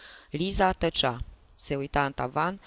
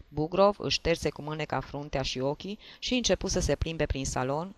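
plain reading voice

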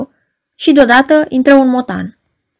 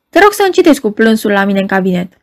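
ordinary delivery, nothing marked

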